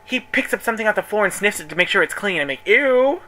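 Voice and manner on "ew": high, loud grossed-out ew; squealed ew of disgust. Retold or imitated. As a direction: recoil in disgust. Disgusted at sniffed floor clothes.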